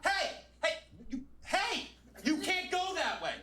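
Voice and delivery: high yelling hey hey; woman calling out. Retold or imitated. Woman stopping a lost man, high calling out. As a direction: call out to stop a stranger walking off. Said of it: "this woman goes".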